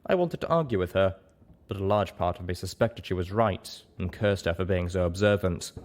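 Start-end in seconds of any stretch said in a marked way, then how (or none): none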